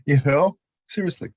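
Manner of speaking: normal talking voice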